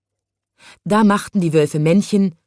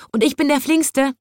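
high-pitched eager cry; mild shout; 9 semitones higher than usual. A narrator's reading. Third man boasting for the zookeeper job, crying out high. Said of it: "schrie ein dritter".

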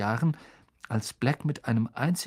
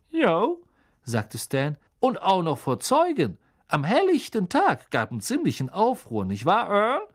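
put-on high, loud, excited young conductor; eager gossip calling to the driver; Stan the conductor; 5 semitones higher, 4 dB louder than usual.

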